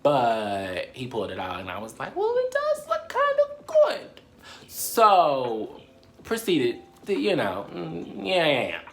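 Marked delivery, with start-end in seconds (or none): 2.2-4.2 very high, slow, coy; mock-innocent squeaky voice; coy falsetto. Retold coy reaction.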